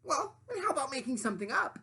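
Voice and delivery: high, loud demanding sandwich voice; high bossy sandwich lady voice. Acted. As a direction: play the pushy sandwich, high and grand. Sandwich replies.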